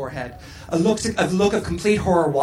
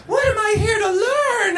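high, panicked wailing question; bewildered panic; 13 semitones higher than usual. A narrator's reading. Tootie questions Missus Garrett while tripping, bewildered panic. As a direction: wail the question high, lost and alarmed. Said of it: "bewildered and panicked Tootie".

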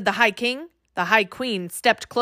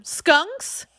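high, slow question flung to the crowd; king shouting; 7 semitones higher, much slower than usual. A narrator's reading. Fairy king asking the crowd, lightly voiced shout. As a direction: offer the crowd a choice. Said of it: "he shouted out at the crowd".

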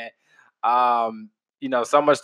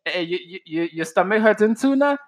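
higher, imitating his West Indian dad; West Indian accent; 8 semitones higher than usual. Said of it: "my pops, West Indian as fuck".

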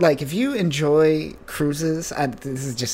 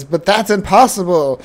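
mildly dramatic anime hero, a bit louder; anime character line; 4 semitones higher, 8 dB louder than usual. Retold or imitated. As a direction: play it like a shocked anime hero, lightly. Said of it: "Just like a Japanese character".

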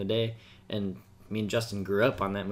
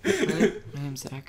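imitating Zach; 3 semitones higher, much slower than usual. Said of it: "I guess I kinda like this voice".

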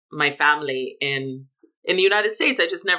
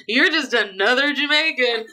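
mock dismissive quote; 8 semitones higher than usual. Mocking how locals saw him.

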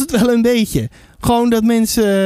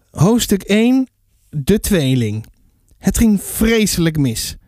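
lower-pitched storybook reading voice; story narration; 5 semitones lower than usual. A narrator's reading. Author reads a little piece of the book to listeners, story narration. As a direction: read the chapter out as a storyteller.

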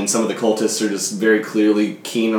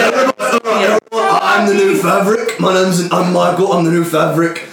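boastful goblin; 7 semitones higher than usual. Acted. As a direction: proclaim yourself the new leader. GM voices goblin leader Michael.

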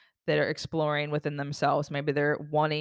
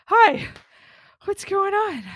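excited greeting; 13 semitones higher, 7 dB louder, slower than usual. Retold or imitated. Greeting friends with big excitement.

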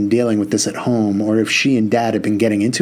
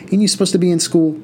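higher, quick teasing question; teasing mom; 7 semitones higher, faster than usual. A narrator's reading. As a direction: tease her like a mom. Mother teasing.

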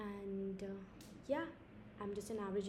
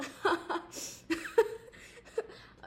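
breathy high-pitched giggle; 11 semitones higher, 4 dB louder than usual. Real, not acted. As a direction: let out a squeaky giggle. Laughs at own joke.

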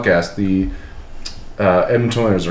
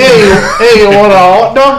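put-on higher, louder New York vendor accent; 10 semitones higher, 6 dB louder than usual. Host riffing in a higher, louder New York accent. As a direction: call out like a New York cart guy. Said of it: "Can you do it in a New York accent now?".

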